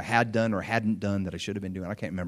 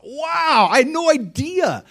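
much higher, stunned wow; amazed; 13 semitones higher, 5 dB louder, slower than usual. Retold or imitated. Pastor recalling his amazement at her thanks, high and stunned. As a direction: let the surprise leap up in pitch.

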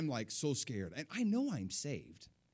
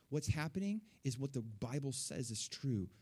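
ordinary delivery

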